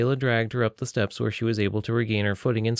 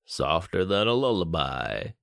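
slow and dreamy, a bit lower; dazed drawl; 3 semitones lower than usual. Acted. Benny mumbles from his daze, slow and dreamy. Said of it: "drugged Benny".

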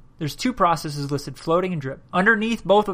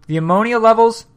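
words stretched by a yawn; 5 semitones higher, 7 dB louder than usual. Real, not acted. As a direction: talk through a yawn. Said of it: "Sorry, I'm yawning here".

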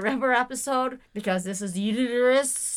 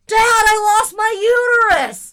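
high, loud, slow cry to dad; panicked wail; 12 semitones higher, 12 dB louder, slower than usual. Retold or imitated. Neighbor screams to her dad, horrified. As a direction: scream it in horror. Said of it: "But just".

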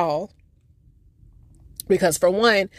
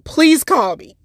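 a hint of the aunt, higher and louder; eager auntie; 8 semitones higher, 6 dB louder than usual. Auntie asking her niece to call, eager. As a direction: plead lightly in auntie's voice. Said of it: "she was like".

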